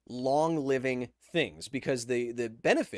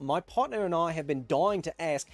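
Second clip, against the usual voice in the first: put-on Australian accent. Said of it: "Again, I feel like I nailed that".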